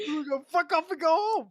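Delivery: high, laughing echo of the jibe; cracking up while repeating the put-down; real laughter. Real, not acted. Echoes co-host's jibe amid laughter.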